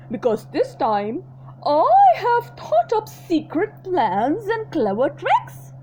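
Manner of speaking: a greedy crocodile gloating over his secret plans; sly crocodile. Acted. Crocodile telling the small croc his plans, slow gloating.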